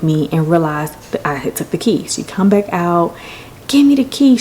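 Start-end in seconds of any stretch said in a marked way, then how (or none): 3.7-4.4 high-pitched insistent demand; mock frantic demand. Girlfriend kicking her for the key, mock frantic. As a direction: press for it on a high, urgent note.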